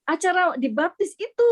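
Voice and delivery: high-pitched retold insistence; lifted voice, imitating an eager reply; eager announcement. Father explains he wants to be baptised, eager.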